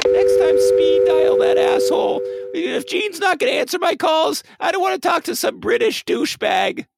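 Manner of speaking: fed up and ranting; angry caller. Pretend.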